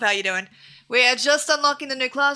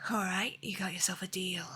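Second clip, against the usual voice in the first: whispered deal; 4 semitones lower, 10 dB quieter than usual. Acted. Character agrees.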